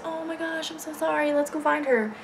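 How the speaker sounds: high, softened imitation of his apology; put-on sympathetic voice, high and light; mock apologetic boyfriend.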